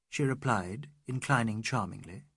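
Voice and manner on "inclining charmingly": ordinary delivery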